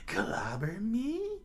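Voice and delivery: high cackling villain voice; squeaky incredulous clown villain; Buggy, mocking. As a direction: repeat it slowly in amused disbelief, rising high. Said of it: "Buggy said".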